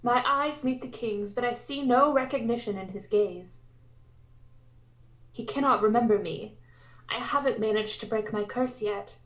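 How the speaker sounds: usual speaking voice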